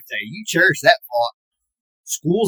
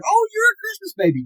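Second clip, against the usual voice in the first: put-on much higher, sing-song oh you're a Christmas baby; mock cutesy voice. Cashier checking ID, cooing in a high sing-song. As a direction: coo it like an amused cashier. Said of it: "I heard".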